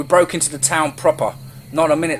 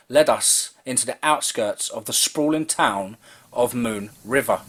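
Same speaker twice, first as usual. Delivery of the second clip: ordinary delivery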